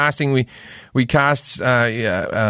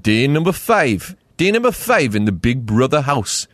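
Newcastle accent. Shown as a demonstration. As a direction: imitate the Geordie Big Brother narrator. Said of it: "like, Newcastle is".

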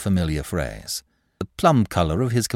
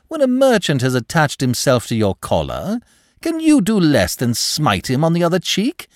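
higher, animated self-justification; suave, glib clerk; 7 semitones higher, 4 dB louder than usual. Acted. Clerk defends his behaviour to the manager, glib. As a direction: protest innocence in rising, airy tones.

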